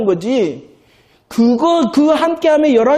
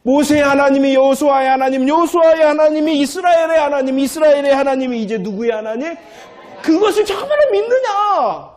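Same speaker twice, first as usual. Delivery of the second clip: fervent preaching; 6 semitones higher than usual. Real, not acted. Preacher challenging the congregation, rising to a high shout. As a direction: build it up to a ringing challenge.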